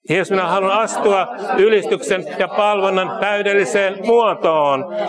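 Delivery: high, loud, forceful praying; fervent declaration prayer. Real, not acted. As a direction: lead the prayer line by line with force. Leads a declaration prayer line by line.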